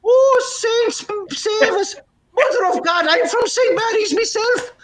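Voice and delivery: very high, astonished Irishman; Irish brogue. Acted. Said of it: "a bit of a brogue".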